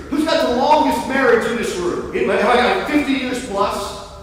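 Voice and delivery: ordinary talk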